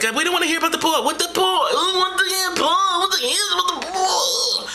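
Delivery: very high, squeaky mocking townsfolk voice; mock squeaky crowd. Townsfolk whining at Jesus in a high squeaky voice.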